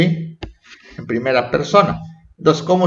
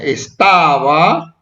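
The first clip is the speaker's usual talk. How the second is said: "estaba" slow, with a lengthened middle; drawn-out word; much slower than usual. Shown as a demonstration. Teacher picking out past verbs for pupils, drawn-out "estaba". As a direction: stretch "estaba" out slowly.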